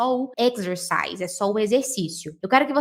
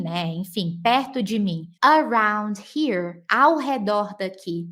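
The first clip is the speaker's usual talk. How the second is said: usual speaking voice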